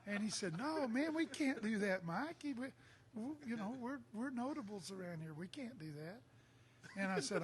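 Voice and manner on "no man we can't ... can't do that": alarmed protest. Retold or imitated. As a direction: plead him out of the fight.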